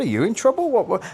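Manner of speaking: suspicious stranger. Retold or imitated.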